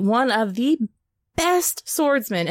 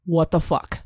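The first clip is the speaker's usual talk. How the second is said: deadpan punchline; 5 semitones lower than usual. Shown as a demonstration.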